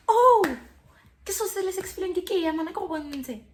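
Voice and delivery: high, loud exclamation into rapid rant; hammed-up shouted tirade; acted outburst. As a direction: burst out high and loud, then race on. Acting out a furious outburst.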